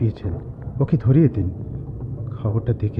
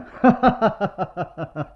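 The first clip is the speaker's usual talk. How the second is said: amused laugh; 8 semitones higher than usual. Acted. Friend laughs at his friend.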